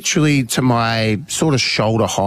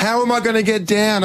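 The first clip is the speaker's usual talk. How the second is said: high-pitched mock panic; imitated bawling child; 9 semitones higher than usual. Daughter asks dad for rescue, mock-tearful plea. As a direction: mimic a stuck kid wailing for help. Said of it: "bawling her eyes out".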